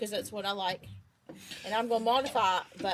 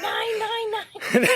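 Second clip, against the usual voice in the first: mock German shout; 13 semitones higher, much slower than usual. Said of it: "That's the only German Heather knows".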